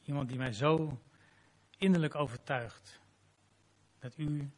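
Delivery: closing prayer after the sermon. Real, not acted.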